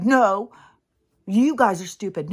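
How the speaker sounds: loud jeering retort; mock yell. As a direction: shout it like a petty online fight. Mocking arguing crowd.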